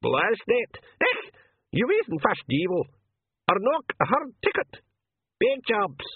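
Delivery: high, excited pixie rabble; high-pitched little creatures clamouring; roaring pixies. A narrator's reading. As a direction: squawk it out like a tiny angry mob. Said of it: "A roar went up".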